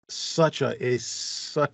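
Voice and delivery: ordinary talk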